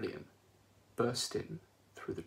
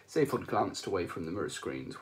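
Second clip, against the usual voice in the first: usual speaking voice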